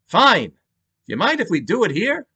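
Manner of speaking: high, loud New York detective; curt New York cop; New York accent. Acted. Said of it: "thick New York accent".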